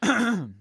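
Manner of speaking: harsh throat clearing from dust; clearing dusty throat. Real, not acted. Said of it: "You can hear that I have dust in my throat".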